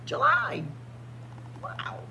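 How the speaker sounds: ordinary delivery, nothing marked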